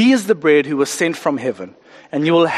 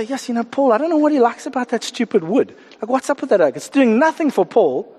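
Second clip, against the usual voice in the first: higher-pitched mock complaint; gossipy grumbling; 6 semitones higher than usual. Church member moaning to Bob about Paul, mock grumbling. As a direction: gripe about someone behind his back. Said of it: "All we're doing is grumbling amongst us".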